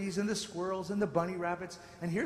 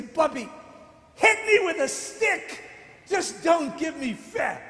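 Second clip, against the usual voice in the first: higher, slow, laughing mock plea; pleading boy; 8 semitones higher, 7 dB louder, slower than usual. Boy begging his grandmother, mock pleading with a laugh. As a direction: beg like a kid caught out. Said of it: "and I'd say".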